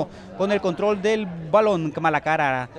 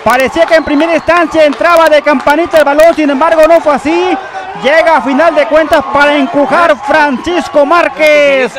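loud, high excited recap; breakneck goal commentary; 7 semitones higher, 8 dB louder than usual. Real, not acted. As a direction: still riding the goal high. Replay after the goal. (narración emocionada tras el gol)

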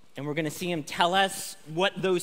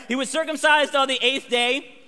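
louder, higher restart; 6 semitones higher, 7 dB louder than usual. Shown as a demonstration. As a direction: read it again louder and higher, with fresh force. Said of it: "All right".

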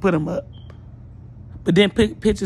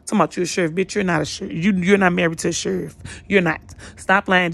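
ordinary delivery

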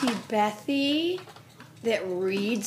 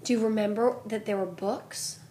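usual speaking voice

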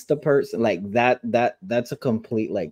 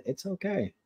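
soft, higher-pitched comfort; gentle reassurance; 7 semitones higher, 10 dB quieter than usual. Retold or imitated. Voicing a friend comforting crying Roxy, soft reassurance. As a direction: imitate someone tenderly soothing, hushed and lifted.

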